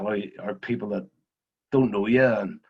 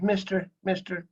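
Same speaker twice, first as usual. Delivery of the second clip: put-on higher, eager kid asking for an autograph; a boy's voice begging a rally driver for his autograph; imitating a young boy; 8 semitones higher, 4 dB louder than usual. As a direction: ask hopefully like a wee lad at the car window. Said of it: "He says".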